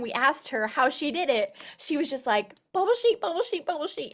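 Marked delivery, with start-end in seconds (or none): 2.7-4.1 lightly imitated accent of Shannon, higher pitched; a playful higher-pitched hint of her accent; imitating Shannon's accent. Host imitating a fellow director's catchphrase in her accent. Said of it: "in her cute little accent".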